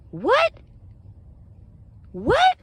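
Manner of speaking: very high, disbelieving "What?" shriek; incredulous shriek. Real, not acted. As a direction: squeal it out in shocked disbelief. Outraged disbelief at society.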